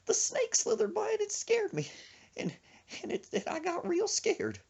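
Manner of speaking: very high, timid falsetto; mock-frightened tiny voice with halting pauses; scared friend. Frightened friend confesses to dad, high scared imitation. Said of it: "he does that when he gets scared".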